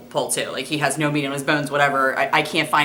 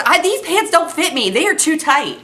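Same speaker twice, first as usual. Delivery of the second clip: put-on whiny kid; 11 semitones higher, 3 dB louder, slower than usual. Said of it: "So he's".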